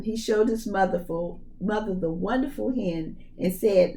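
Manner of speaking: plain reading voice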